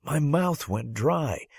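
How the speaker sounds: normal talking voice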